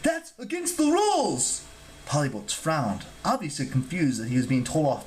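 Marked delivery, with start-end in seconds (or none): 0.0-1.6 high-pitched, outraged god; fussy outrage, pitched way up; indignant cry. A narrator's reading. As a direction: cry out in protest. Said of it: "Terminius cried".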